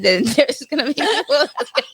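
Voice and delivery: words dissolving into high laughter; laughing through words. Real, not acted. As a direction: let the laugh take over mid-sentence. Laughter breaks through speech.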